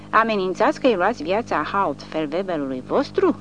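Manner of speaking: plain reading voice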